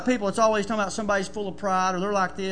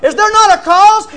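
much higher and louder, hammering NOT; shouted preaching; 10 semitones higher, 14 dB louder than usual. Acted. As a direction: shout the question out to the church. Shouted climax of the verse.

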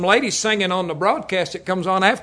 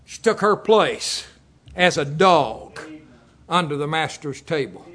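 slow solemn emphasis; 4 semitones lower, slower than usual. Acted. Slow solemn sermon point.

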